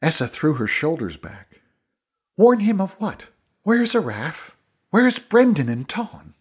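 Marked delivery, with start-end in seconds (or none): 2.4-6.3 high, insistent questioning; urgent demand. Acted. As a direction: press for answers, pitched up and increasingly alarmed. Essa demands to know about missing friends.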